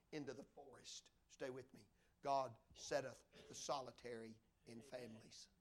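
low, very soft sermon narration; hushed storytelling. A narrator's reading. Preacher telling of a boy alone in an orphanage, hushed. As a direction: drop low and quiet for the sad part.

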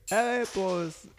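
high-pitched mock whine of a buddy; Javi impression. Friend imitating his buddy at the door, high put-on voice. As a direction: put on your friend's high, drawn catchphrase voice. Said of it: "And the way he talks".

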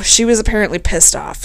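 usual speaking voice

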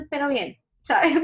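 high, bright fake-fine voice; forced cheerful "fine". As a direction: put on a brave face, brightly. Mimics saying she was fine.

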